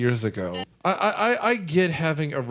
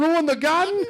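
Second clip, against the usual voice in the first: much higher cartoon wiseguy squeal, rising on garden; exaggerated Brooklyn tough guy; 12 semitones higher than usual. Mocking the movie's Brooklyn character, over-the-top cartoon squeal. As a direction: push the outrage to a cartoon peak. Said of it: "him going".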